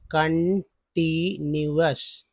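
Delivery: कंटीन्यूअस drawn out very slowly, syllable by syllable; slow syllable demo. Shown as a demonstration. Teacher showing each part of कंटीन्यूअस very slowly. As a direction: spell out कंटीन्यूअस slowly for students. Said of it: "वही उसको S बोलते हैं".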